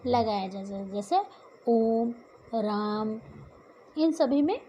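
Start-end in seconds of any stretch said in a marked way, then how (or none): 1.7-3.2 "ओम" and "राम" with the vowel drawn out long; sustained, level "ओम", then "राम"; extra-long vowel. Shown as a demonstration. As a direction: hold the vowels of "ओम, राम" before closing on m. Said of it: "दीर्घ स्वरों से अधिक समय लगता है".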